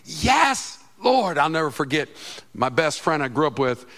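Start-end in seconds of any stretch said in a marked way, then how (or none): 0.1-1.4 high, loud cry of praise; joyful shout. Acted. Preacher showing the church its shout of victory, high and loud. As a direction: shout it out in triumph. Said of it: "when you get to go".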